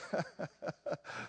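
real breathy laughing fit; quiet, helpless laughing; losing it laughing. Real, not acted. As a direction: try to hold in the laughter and fail. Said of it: "I'm gonna start losing it now".

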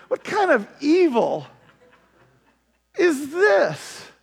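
outraged king, high and loud; a king balking in disbelief; indignant king. Acted. King voiced refusing the request.

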